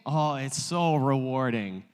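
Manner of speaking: ordinary talk, nothing marked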